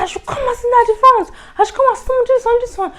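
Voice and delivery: high, loud and fast, begging to sit in the front; rushed frantic pleading, voice jumping up; panicked high plea. Retold or imitated. Scared girl pleading with her dad's driver, high and fast.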